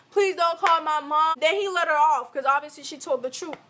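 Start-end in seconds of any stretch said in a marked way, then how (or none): none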